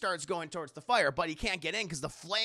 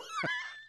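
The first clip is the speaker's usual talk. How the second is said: high burst of laughter; 4 dB quieter than usual. Real, not acted. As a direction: crack up. Laughing at own joke.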